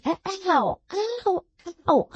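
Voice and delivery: rising high yelps of pain; pained ows. Acted. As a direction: cry out at each sting. Beaten man in pain.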